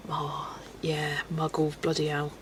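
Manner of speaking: a breathy aside with no voice; under her breath, almost voiceless; whisper. Real, not acted. Whispered aside while reading chat.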